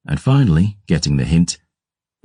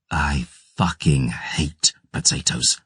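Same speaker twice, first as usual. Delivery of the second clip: weary disgust; 5 dB quieter, slower than usual. Acted. Gabriel's weary complaint.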